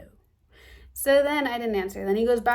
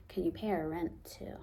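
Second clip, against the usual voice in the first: soft, sarcastic repeat of the request; quietly mock the ask, dripping with disbelief; muttered sarcastic echo; 3 semitones lower, 9 dB quieter than usual. Mocking the mom's request.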